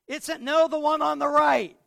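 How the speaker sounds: put-on high, loud boyish correction; young boy calling out to mom; kid voice. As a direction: point her to the right shelf like a kid. Child voice directing mother.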